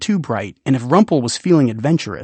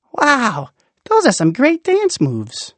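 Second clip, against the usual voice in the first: put-on much higher, delighted child; high kid voice; 10 semitones higher than usual. Imagined kid above ground admiring dance moves, high and delighted. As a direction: gush like an impressed kid.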